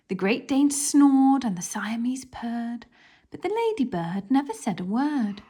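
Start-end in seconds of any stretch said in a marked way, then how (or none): none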